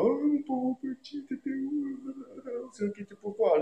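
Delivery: mock a parent fussing over a lost child; mock pitying parent. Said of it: "c'est pas".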